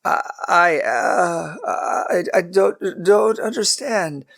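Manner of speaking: high, halting stammer, slowing at the end. A narrator's reading. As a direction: trip over the words in panic. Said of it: "Abraham stammered".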